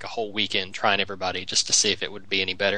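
ordinary talk

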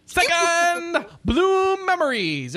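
high, stretched-out title shout; Japanese segment announcer. Shown as a demonstration. Podcast host introducing the next game, high drawn-out announcer call. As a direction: hold each title word long and high. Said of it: "trying to do it like a Japanese segment".

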